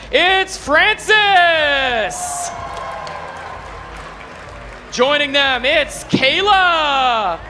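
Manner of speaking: drawn-out hype arena introductions; fighting game announcer. Acted. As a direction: stretch each name like a ring announcer. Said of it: "I'm your guest game announcer".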